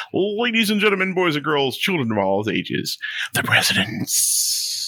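booming showman announcement building to a hush; ringmaster. Pretend. Announcing the presidents like a circus.

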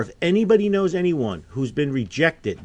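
normal talking voice

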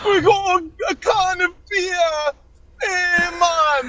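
high mock British sliding toward Jamaican; joke British-Jamaican accent. Guest testing the beer can trick, mock British-Jamaican. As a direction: pitch it up in a silly put-on British-Jamaican accent. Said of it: "beer can with a British accent".

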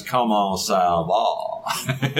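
put-on very slow French with a Southern accent; imitating a New Orleans musician greeting in French. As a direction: drawl the French greeting Louisiana style. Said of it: "He's got that southern accent".

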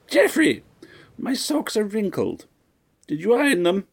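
very high, piercing matron's voice; shrill old lady. A narrator's reading. Demanding mistress calling her butler, high and shrill. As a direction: screech it like a fussy rich widow. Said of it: "as shrill as the chirping of the smoke detector".

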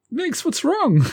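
high-pitched concerned character voice; Toby. Acted. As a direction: ask in a high, alarmed voice. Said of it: "then you hear Toby saying".

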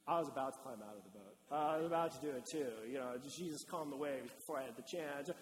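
low, hurried excuse-making; mumbled face-saving bluster; sheepish disciples. Acted. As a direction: play guys covering for their cowardice.

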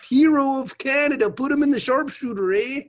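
high, loud hammed-up Canadian alarm; broad joke Canadian accent; mock Canadian. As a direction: play a panicked Canadian in a comic skit. Mock Canadian zombie panic bit.

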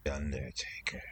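eerie hushed whisper. Acted. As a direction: breathe the name like a secret. Echoes host's big name-drop.

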